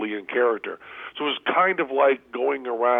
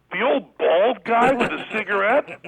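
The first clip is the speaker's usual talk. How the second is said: much higher, louder incredulous question; mock disbelief; 9 semitones higher, 4 dB louder than usual. Counter girl realising who she served: high mock disbelief. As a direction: sell the shock that it was him.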